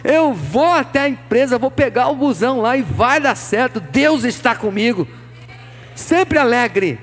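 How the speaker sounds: loud, rising sermon climax; preaching at full force. Acted. (pregação inflamada, cada vez mais alta)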